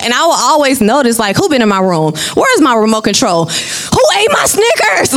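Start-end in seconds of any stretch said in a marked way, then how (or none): 3.4-5.1 higher, slow, half-joking outrage; mock indignant. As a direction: replay her own exasperated question. Retelling finding her things taken.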